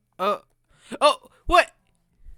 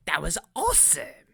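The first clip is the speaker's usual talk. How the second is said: wrong character voice. Acted. Kid monster cheering what he saw, in a swooping cartoon voice. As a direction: slip into a swooping, theatrical character voice. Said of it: "stop using Paris accent".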